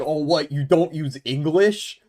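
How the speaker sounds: a hint of a baffled tourist; clueless tourist. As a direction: play the oblivious visitor, lightly. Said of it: "wouldn't it be more racist of me to be like".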